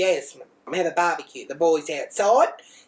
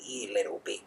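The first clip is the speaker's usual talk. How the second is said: much lower and far softer, under her breath; muttered insult; 9 semitones lower, 10 dB quieter than usual. Retold or imitated. Mum cursing the lying child under her breath, low.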